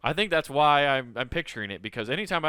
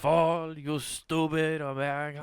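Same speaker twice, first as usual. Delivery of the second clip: put-on drawn-out French-accented contempt; slower than usual. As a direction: sneer it like a mock French smoker. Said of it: "The French are watching that with glee".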